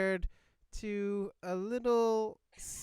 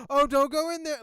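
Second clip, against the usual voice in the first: excited audience shout; 8 semitones higher, 8 dB louder than usual. Retold or imitated. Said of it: "a lot of people were just like".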